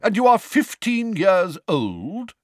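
slow, high, loud question; booming. A narrator's reading. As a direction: boom it out slowly, incredulous. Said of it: "boomed Madam Bones".

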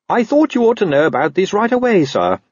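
high-pitched, keen constable; reporting constable. Acted. Constable brings news to his superiors, keen report.

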